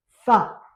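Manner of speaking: Fa called out loud and clear; teacher modelling Fa; loud model syllable. Shown as a demonstration. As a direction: sound out Fa loudly for the class. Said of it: "ça nous donne".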